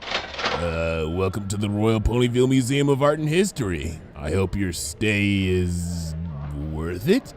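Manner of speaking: doubtful clerk drawing it out; lightly voiced cashier. A narrator's reading.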